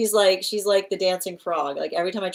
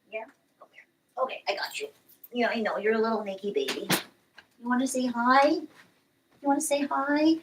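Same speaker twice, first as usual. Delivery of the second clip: high, cooing voice for the cat; 4 semitones higher, 4 dB quieter than usual. Real, not acted. Owner coaxing her cat to greet viewers, baby talk.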